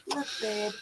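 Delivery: usual speaking voice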